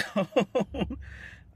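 loud high laugh; burst of laughter. Real, not acted. Vlogger laughing at herself for not knowing the food, loud laugh. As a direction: let out an embarrassed laugh. Laughs after admitting ignorance.

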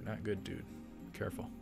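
ordinary talk

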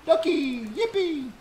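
high squeaky fake cheer; mock cheer. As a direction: squeal it like a cartoon. Sarcastic cheer, far voice.

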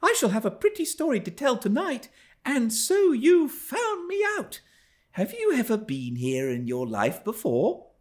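put-on high, lively girl's voice; young girl. A narrator's reading. Girl teasing her nurse who found her. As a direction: play a cheeky young girl.

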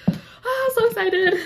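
high-pitched excited squeal; real excitement. Real, not acted. Reader thanking a friend for a book, squealing excitedly. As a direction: burst out high with delight.

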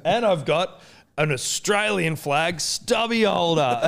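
high, drawn-out imitated announcement; dad's grand reveal. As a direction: unveil the last prize as showman dad. Imitates dad's final gift reveal.

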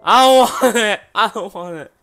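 loud, frightened pleading with a nervous laugh; scared yelling. Real, not acted. Streamer spooked by the horror map, loud scared pleading. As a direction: panic out loud at the horror game.

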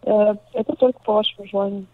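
usual speaking voice

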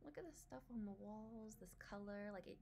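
ordinary talk, nothing marked

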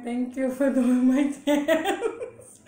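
laughing through the thanks; slow, giggling gratitude. Real, not acted.

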